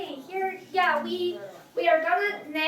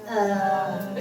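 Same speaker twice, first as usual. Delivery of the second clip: "Uh" low and level; low third tone; 6 semitones lower than usual. Shown as a demonstration. Teacher repeating third tone, low. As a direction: settle "Uh" down at the bottom. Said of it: "That's third tone".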